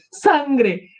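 high-pitched cry at the sight of blood; acted high exclamation; alarmed cry. Same word cried out in alarm.

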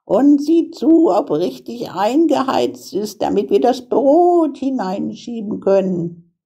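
high-pitched witch giving orders; high, insistent witch voice; commanding witch. A narrator's reading. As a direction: direct the girl as a scheming witch. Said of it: "sagte die Hexe".

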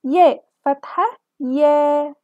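ياء فتحة يا said slowly, يا with a wide open a; teacher sounding out يا with fatha; open vowel sound. Shown as a demonstration. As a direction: open your voice on يا. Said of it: "بتخلينا نفتح صوتنا".

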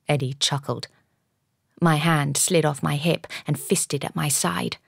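usual speaking voice

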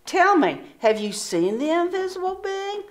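high, probing tone; testing question. Acted. Sister testing the proud sisters, probing. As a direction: test them with a pointed question.

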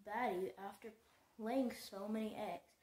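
usual speaking voice

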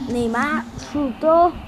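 ordinary delivery

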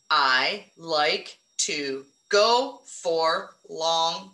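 very slow, word by word; slow counting. Shown as a demonstration. Teacher counting words with her class, very slow. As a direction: stretch it out so each word counts. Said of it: "Count 'em".

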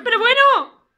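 squawking "well really!"; indignant outburst. Acted. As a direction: explode in mock offence.

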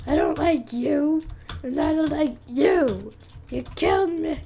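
playful angry yell at other players; mock-angry shout. Real, not acted. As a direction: tell off the rope swingers, loud. Yelling at rope swingers.

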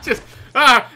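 loud, high cry through laughter; laughing yelp. Real, not acted. Player startled by a game character, laughing yelp. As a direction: yelp while still laughing.

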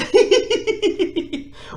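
high, cracking burst of laughter; can't hold back a high laugh; laughing fit. Real, not acted.

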